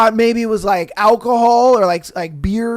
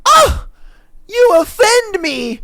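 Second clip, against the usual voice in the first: high, squeaky mock-offended lady voice; imitating the lady; 10 semitones higher, 4 dB louder, slower than usual. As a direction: play the strange woman, pitched way up and indignant. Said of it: "And she's just like".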